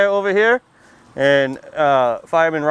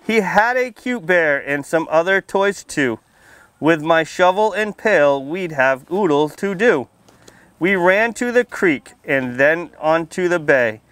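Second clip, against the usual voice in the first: put-on lively storybook read-aloud for little kids; reading to children; 2 semitones higher, slower than usual. A narrator's reading. Firefighter reading a picture book to kids at story time, lively read-aloud. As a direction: read it out bright and clear for the children.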